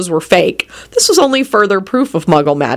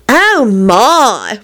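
higher, sighed aside; sighing; 4 semitones higher than usual. A narrator's reading. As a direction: sigh it to your companions. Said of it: "Marlena sighed to Elizabeth and Rosie".

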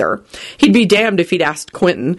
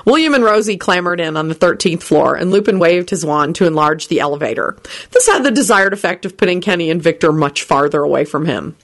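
plain reading voice

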